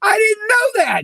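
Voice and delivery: high, loud, laughing disbelief; surprised. Real, not acted. Hearing [name] does the ads, loud laughing surprise. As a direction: blurt it out amazed.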